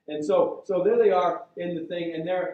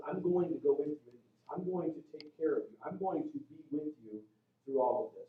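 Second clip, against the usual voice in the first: soft, tender promise; hushed, steady reassurance in a quoted voice; reassuring; 7 dB quieter than usual. Retold or imitated. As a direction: speak God's comfort gently and quietly. God reassuring fearful Gideon.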